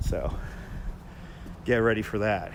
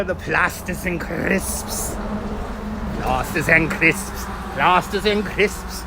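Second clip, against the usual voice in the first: slow, louder hammed-up British accent; mock British accent; 3 semitones higher, 5 dB louder, much slower than usual. Streamer repeats the chat phrase, slow and loud, in a hammed-up British accent. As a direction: savour plasters and crisps in a hammed-up British accent. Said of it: "what kind of accent that was".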